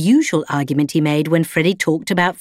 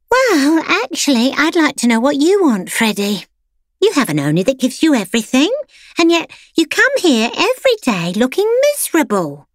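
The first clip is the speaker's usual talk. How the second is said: much higher, put-on voice of a pampered lapdog; prim pampered dog; 9 semitones higher than usual. A narrator's reading. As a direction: lecture him sweetly like a spoiled salon poodle. Clementine character voice.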